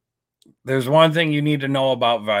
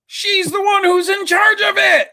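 outraged shout; 13 semitones higher, 4 dB louder than usual. Real, not acted. Mocking outrage at Harris.

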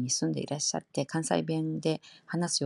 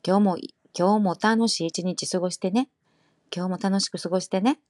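louder, friendly Kansai version; Kansai accent; 2 semitones higher, 5 dB louder, slower than usual. Shown as a demonstration. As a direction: say it cheerfully in Kansai dialect. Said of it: "칸사이 풍으로 하면".

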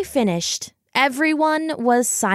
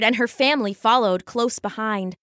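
ordinary delivery, nothing marked

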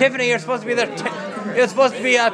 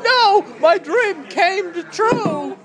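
mock dismay; 9 semitones higher, much slower than usual. Real, not acted. Reacting to dream coming true.

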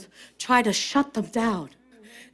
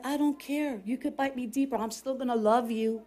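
much softer, held-back declaration; quiet retold vow; 11 dB quieter, faster than usual. Deliverance minister to the demon biting him, quietly steadfast. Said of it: "he said".

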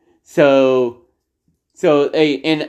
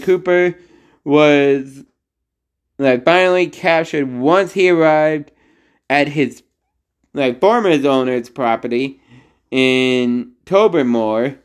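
ordinary talk

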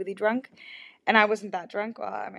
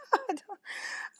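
giggle; 12 semitones higher, 5 dB quieter than usual. Real, not acted. Giddy laugh recalling flirty text.

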